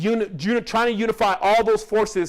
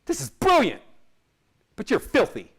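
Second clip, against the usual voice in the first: higher, mock-blunt verdict from the old doctor; imitating the old doctor; 4 semitones higher than usual. Old doctor telling the narrator he is filthy, higher mock voice. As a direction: pitch it up as the doctor delivers bad news. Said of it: "He said".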